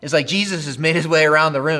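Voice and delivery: ordinary talk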